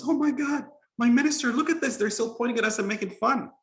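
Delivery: put-on very high, loud child voice; exasperated little boy. A narrator's reading. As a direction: squeal in dismay like a small boy.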